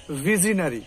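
ভিজনারি ending in open "na-ri"; full "a" before ri in ভিজনারি; common mispronunciation. Shown as a demonstration. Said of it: "আমি যদি বলি".

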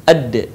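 'Ad' low, d released with a short echo vowel; bouncing d. Shown as a demonstration. Teacher showing a dead da, bouncing 'Ad'. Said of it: "terdengar suaranya memantul".

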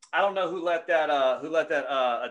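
ordinary talk, nothing marked

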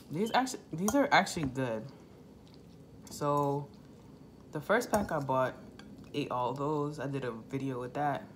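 talking between bites; chewing while talking. Real, not acted. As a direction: mouth partly full. Seen 0: Eats noodles throughout; lips pressed, chewing between spoken phrases.

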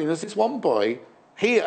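usual speaking voice

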